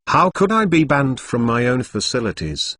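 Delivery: plain reading voice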